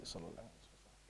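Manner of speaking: whispered blessing under the breath; whispered to yourself. Shown as a demonstration. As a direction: breathe it so only you hear. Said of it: "You just hear yourself quietly".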